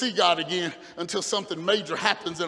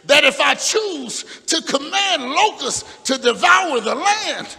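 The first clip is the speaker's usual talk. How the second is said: shouted slow and higher as God; slow preaching shout; 6 semitones higher, 6 dB louder than usual. Acted. Preacher shouting God's warning to Solomon, slow and loud. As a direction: drag it out, a warning from heaven.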